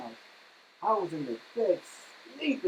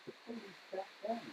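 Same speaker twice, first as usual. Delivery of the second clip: ordinary talk, nothing marked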